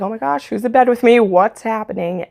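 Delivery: quick, lightly panicked retelling; a touch of hammed-up alarm; mock freaking out. Startled girl finding someone in her bed, mildly freaking out. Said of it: "she's freaking out".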